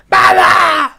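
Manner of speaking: high loud mock shout; imitating a yell. Mocking Furia's shout when someone leaves, loud mock yell. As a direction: bellow it like Furia at a goodbye. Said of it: "la furia que grita".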